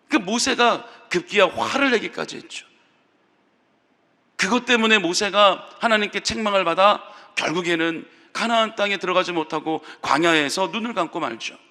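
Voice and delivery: ordinary talk, nothing marked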